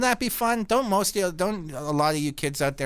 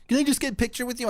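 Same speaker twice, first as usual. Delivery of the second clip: put-on pleading kid fan; 12 semitones higher, much faster than usual. Kid fan asks again.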